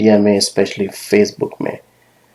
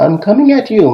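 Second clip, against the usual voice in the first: high-pitched repeated answer; third call back; 9 semitones higher, much slower than usual. Acted. Answering the third shout from outside, high-pitched call back. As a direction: call back after being summoned repeatedly. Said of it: "تیسری دفعہ کہا".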